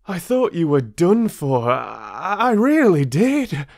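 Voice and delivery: high, loud relieved gush. Acted. Young traveller telling friend he feared him dead, high relieved voice. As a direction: spill out the relief.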